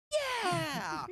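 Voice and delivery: high, loud cheer; retold scream. Costumed guy cheering the friends' answer, screaming. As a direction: imitate his full-throated "yeah". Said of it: "Like, screams and puts his arms out".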